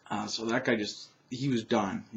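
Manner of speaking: ordinary delivery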